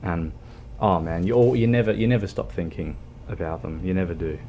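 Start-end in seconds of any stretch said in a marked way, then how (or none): none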